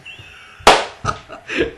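loud burst of real laughter; big belly laugh. Real, not acted. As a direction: burst out laughing at your own line. Cracks up after Magic Man line.

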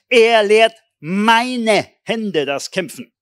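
a preacher thundering a favourite verse; proclaiming the psalm. Shown as a demonstration. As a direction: declaim it like a battle cry. Reciting psalm with force.

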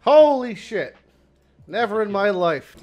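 high, drawn-out disbelief; real amazement. Real, not acted. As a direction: cry out as if you hit the jackpot. Big drop in the game.

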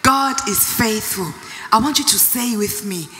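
ordinary talk

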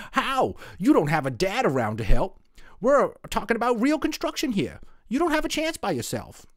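high, scoffing schoolboy jeer; mocking kid voice. Jimmy laughs and jeers that Paisley can't build alone. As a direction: sneer at her like a cocky kid.

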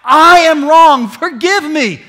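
loud, high, slow plea; shouted confession to God; repentant prayer. Acted. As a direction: confess it with everything. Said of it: "someone repents and says".

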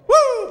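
high joyful whoop, real; exultant whoop. Real, not acted. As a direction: let out a big church woo. Preacher's exultant cry.

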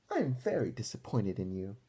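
ordinary delivery

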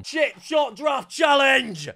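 slow, drawn-out announcer call of the title; hyped title announcement. Acted. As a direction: boom out the challenge name like a show intro.

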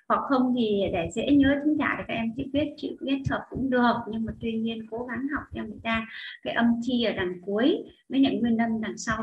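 ordinary talk

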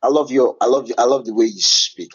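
normal talking voice

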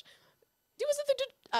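high mock British, abandoned midway; failed British accent. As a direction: try a squeaky British voice and give up. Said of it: "can't do a British accent".

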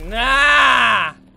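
loud satisfied nah; triumphant shout. Real, not acted. Gamer cheering a kill, triumphant shout. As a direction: bark it as the monster drops. Monster finally dies.

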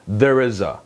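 "There is a" run together lightly; low-key, unstressed "There is a"; smooth linking. Shown as a demonstration. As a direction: glide through "There is a" without a break. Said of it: "매끄럽게 해주시고요".

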